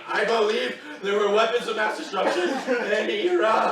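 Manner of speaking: put-on pinned kid forced to recite politics; shouted forced admission. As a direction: scream the line just to be let go. Forced statement while pinned.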